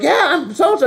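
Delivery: high, loud mock introduction; imitated cheery greeter. Voices the grown-up kid answering.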